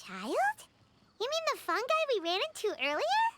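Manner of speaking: high bubbly little companion; squeaky eager fairy chatter; cheerful tiny floating sidekick; chirpy sidekick voice. Acted.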